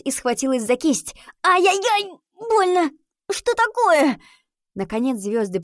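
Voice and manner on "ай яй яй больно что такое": high, loud pained cries; pained cry. Acted. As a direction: cry out in pain. Girl in sudden pain.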